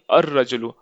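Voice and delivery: अर-रजुलु with a doubled r; silent L. Shown as a demonstration. Teacher gives the word after its meaning, अर-रजुलु without L. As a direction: go straight to the long r in अर-रजुलु. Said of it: "नहीं बोलेंगे हम".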